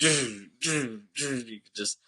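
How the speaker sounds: drawn-out mock smashing sound effects; imitated pounding noises. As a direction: vocalize slow, repeated blows on metal. Imitates monster pounding the ship.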